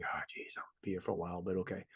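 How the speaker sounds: under-the-breath grumble to himself; whispered thought. Retold or imitated. Waiting in the rain behind cars, a whispered aside. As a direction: breathe it out like a private sigh of patience.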